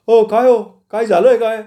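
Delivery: high, loud startled question; astonished. A narrator's reading. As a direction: farm owner taken aback, voice shooting up. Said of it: "आश्चर्यचकित होऊन त्याने विचारलं". (आश्चर्यचकित, चढा मोठा आवाज)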